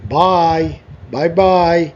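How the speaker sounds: demonstrated goodbye. Pretend. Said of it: "তাহলে তুমি কি বলবা".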